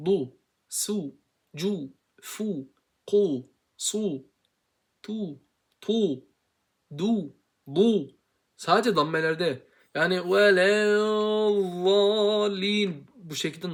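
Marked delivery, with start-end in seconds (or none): 0.1-8.1 "Du, su, ku" con labios redondeados, más agudo; damma drills. Shown as a demonstration. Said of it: "dammelerde şu şekil olur". 10.0-13.7 "velevvalin" muy lento, labios redondeados de más; wrong reading demo. Shown as a demonstration. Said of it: "normalde okunmaz".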